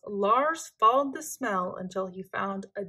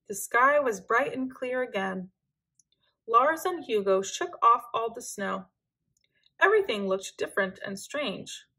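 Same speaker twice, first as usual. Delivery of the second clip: ordinary delivery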